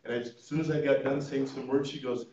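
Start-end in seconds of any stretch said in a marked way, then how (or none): none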